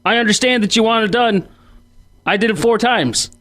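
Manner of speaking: ordinary delivery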